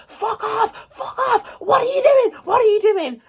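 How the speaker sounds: high, shrill retold scream. Drunk in the cubicle, screaming at the bouncer. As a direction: yell it high at the bouncer at the door. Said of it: "So I'm, like, screaming".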